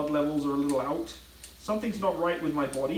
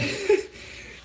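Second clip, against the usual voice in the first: laugh. Real, not acted. Reacting to viewer question, laughing.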